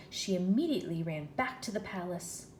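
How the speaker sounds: usual speaking voice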